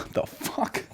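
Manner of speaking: whispered, incredulous aside; hushed, voiceless mock bewilderment; baffled whisper. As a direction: say it under your breath in disbelief. Waiter privately baffled by the request.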